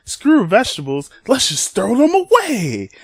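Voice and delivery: high, loud, hammed-up defiance; rebellious Winter. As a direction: crow it, stretching out the last words. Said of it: "And then Winter's like".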